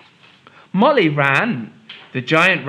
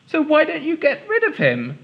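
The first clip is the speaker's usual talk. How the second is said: put-on high bold little girl; a clever girl pressing; 13 semitones higher than usual. Molly to king.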